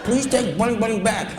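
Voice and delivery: high mock mother's voice; crazy woman's voice. Crazy woman answers kids and begs clerk, high mock voice. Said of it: "this woman talked like this".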